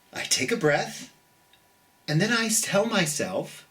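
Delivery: gentle storybook reading for little ones; reading to children. Acted. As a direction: read it like bedtime story time. Reads the picture book aloud.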